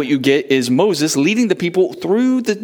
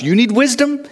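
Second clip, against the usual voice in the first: asking listener; 9 semitones higher than usual. Pretend. Call and answer refrain.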